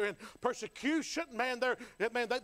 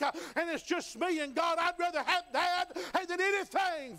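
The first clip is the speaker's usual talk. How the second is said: building, climbing higher toward the peak; testify, rising toward the climax; preaching at full force; 5 semitones higher, 4 dB louder than usual. Acted.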